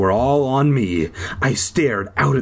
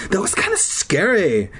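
higher interrupting character; lightly acted, pitched-up interjection; Tina's voice; 7 semitones higher than usual. A narrator's reading. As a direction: cut in with a raised voice.

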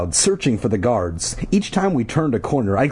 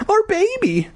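high, panicked mother's cry; frantic cry. A narrator's reading. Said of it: "the woman cried".

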